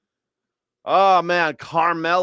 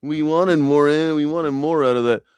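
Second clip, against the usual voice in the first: mock dopey voice; 5 semitones higher, 4 dB louder than usual. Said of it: "This guy's like".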